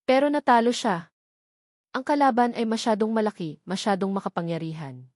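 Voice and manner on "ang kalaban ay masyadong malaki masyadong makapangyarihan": usual speaking voice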